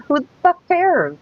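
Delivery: imitating an angry retort; angry outburst. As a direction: fire it back like an angry daughter. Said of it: "Alice says".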